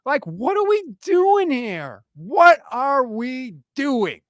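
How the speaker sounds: slow it down in disbelief; exasperated disbelief. Real, not acted. Exasperated rant end.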